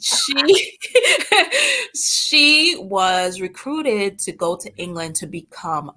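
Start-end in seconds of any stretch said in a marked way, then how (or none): 0.8-1.9 a burst of high laughter. Real, not acted. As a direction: let out a real high laugh. Laughing at the story.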